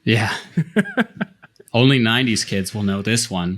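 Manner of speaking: talking through a laugh. Real, not acted. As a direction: say it while still laughing. Joking about nineties memes.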